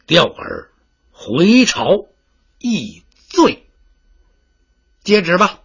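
slow, drawn-out imperial proclamation; reading a decree. Acted. Envoy reads the emperor's decree to the general, slow and pompous. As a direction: intone the edict with pompous weight.

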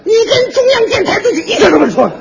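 high, loud hammed-up wail with laughter; mock outrage. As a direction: pretend to be wronged, howling. Fake outrage over his praise.

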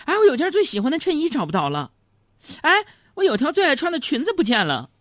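high-pitched imitation of a fretting wife. Wife hunting through boxes for spring clothes, high fretful voice.

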